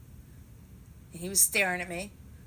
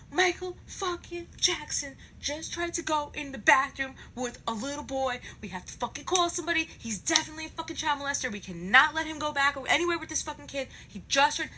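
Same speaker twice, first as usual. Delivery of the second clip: high, urgent insistence; freaking out; 8 semitones higher than usual. Retold or imitated. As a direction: re-enact raising the alarm, pitched up. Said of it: "And I'm freaking out".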